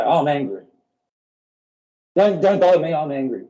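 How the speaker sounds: hammed-up irritation, brushing someone off; mock angry outburst. Acting out a self-excusing angry person.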